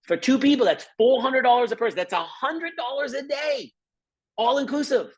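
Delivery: almost shouting with excitement about the price; excited. Real, not acted.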